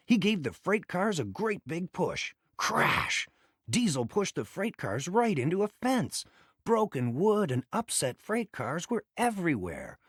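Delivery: ordinary delivery, nothing marked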